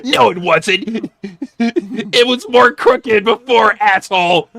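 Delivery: slow, drawn-out indignant comeback; lightly voiced Punk sulking back; mock Punk retort. Punk snapping back at Barrett, slow mock retort.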